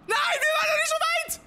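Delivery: high, loud anguished cry; shrieking no at the screen; desperate shout. Real, not acted. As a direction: yell it in disbelief as you die. Monster catches him, despair.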